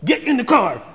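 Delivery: hammed-up exasperated parent, not really shouting; snapping parent. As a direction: play the fed-up parent calling time. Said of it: "they snap".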